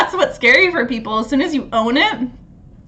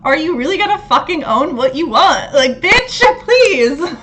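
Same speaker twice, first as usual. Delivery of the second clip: louder, higher mock scolding; sassy challenge; 5 semitones higher, 4 dB louder than usual. Uranus voiced as a friend shaking you, mock-scolding loudly. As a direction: call them out with playful sass. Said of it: "Uranus is, like, shaking you and being like".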